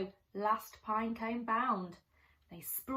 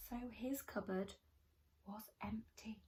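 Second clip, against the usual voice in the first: whisper; 10 dB quieter, faster than usual. Acted. Hushed storytelling of empty cupboard.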